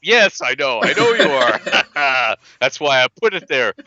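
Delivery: laughing. Real, not acted. Laughing at own joke.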